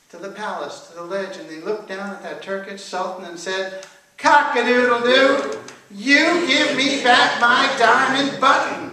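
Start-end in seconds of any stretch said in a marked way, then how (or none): 4.2-8.9 slow, loud, high crowing chant; drawn-out sing-song rooster demand; rooster call-and-response. A narrator's reading. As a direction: lead the audience in the rooster's crow. Rooster crows refrain at Sultan.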